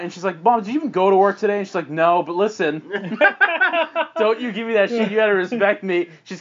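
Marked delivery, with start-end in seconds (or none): 0.4-1.6 put-on fast, high, louder teenage retort; accusing challenge. Daughter challenges mom in quarrel. 1.9-6.1 tipsy scolding mom. Acted. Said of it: "already a little drunk".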